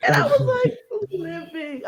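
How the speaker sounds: very high, slow, loud laughing shriek; laughing scream. Real, not acted. Podcaster reacting to a show moment, shrieking with laughter.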